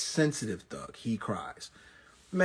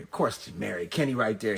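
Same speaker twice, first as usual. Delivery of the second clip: ordinary delivery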